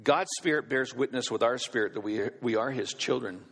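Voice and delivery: ordinary talk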